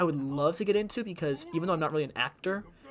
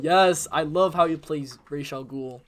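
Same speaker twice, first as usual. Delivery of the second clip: normal talking voice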